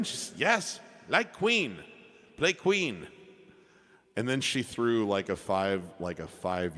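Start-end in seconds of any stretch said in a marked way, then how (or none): 0.4-3.1 imitating an elderly Chinese woman's accent, high and eager; high, sweet Chinese-accented English, asking hopefully; lightly hammed-up Chinese accent of an older lady; adorable Chinese accent. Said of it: "in her absolutely adorable accent".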